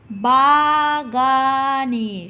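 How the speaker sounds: very slow, each syllable stretched out; slow motion. Shown as a demonstration. Teacher showing how to scan verse, slow-motion syllables. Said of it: "slow মোশনে পড়বে".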